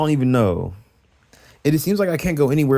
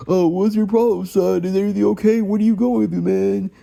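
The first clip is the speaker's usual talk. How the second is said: mocking dad; 5 semitones higher than usual. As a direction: parody the dad's fake sympathy. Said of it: "emotional problem".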